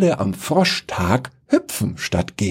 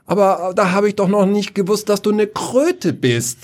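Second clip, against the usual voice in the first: a spoiled prince protesting; indignant protest; 12 semitones higher than usual. Acted. Prince protests he didn't know she was a toad, whiny.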